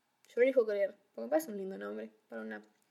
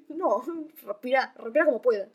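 loud, pressing coaching; loud urging; 9 dB louder than usual. Retold or imitated. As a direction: urge her to breathe loud. Urging friend at concert.

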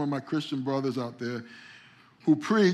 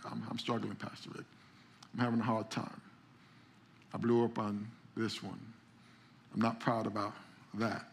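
much softer, nearly whispered admission; honest confession; 3 semitones lower, 6 dB quieter than usual. Retold or imitated. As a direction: confess it quietly, barely voiced. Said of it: "the honest person to tell me honesty".

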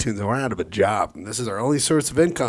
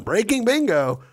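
ad tagline; 10 semitones higher than usual. Acted. Ad tagline sign-off.

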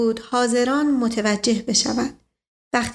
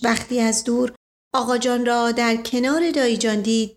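usual speaking voice

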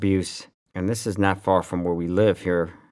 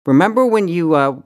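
raised, louder angry rant, much higher; angry callout; 4 semitones higher, 5 dB louder than usual. Real, not acted. Host yelling straight at Megyn Kelly, angry. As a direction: shout her down with contempt.

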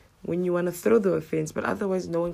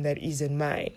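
normal talking voice

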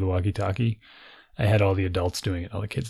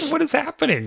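bewildered. Retold or imitated. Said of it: "So I'm like".

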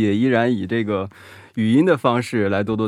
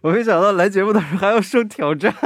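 laughing while talking; 10 semitones higher, faster than usual. Real, not acted. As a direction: giggle through the words. Laughing reaction.

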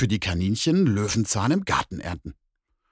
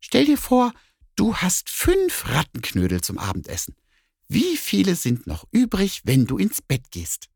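quizzing cat; 10 semitones higher than usual. Acted. Cat sets the bear a sum, high and playful.

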